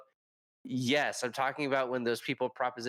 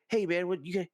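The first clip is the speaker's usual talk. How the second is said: higher-pitched mock confrontation; indignant challenge; 8 semitones higher, much faster than usual. Offended player to the storyteller, indignant challenge. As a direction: imitate an offended man squaring up. Retold in-character quarrel.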